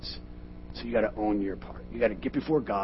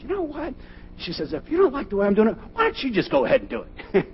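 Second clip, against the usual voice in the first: higher, louder mock wife voice; wife's retort; 14 semitones higher, 8 dB louder than usual. Imitating the wife snapping back about the money, higher and louder. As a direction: fire back as the exasperated wife. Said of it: "she said".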